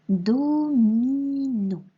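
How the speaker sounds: "domino" drawn out as do-mi-no; dictated syllables. Shown as a demonstration. Teacher dictating a word to write, slow syllables. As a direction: stretch each vowel of "domino". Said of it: "on va écrire le mot".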